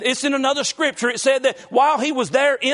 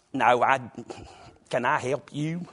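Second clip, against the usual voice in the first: put-on Southern belle accent; 10 semitones lower, 5 dB quieter than usual. Pastor imitating his wife answering the office phone in Southern accent. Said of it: "How did you say that?".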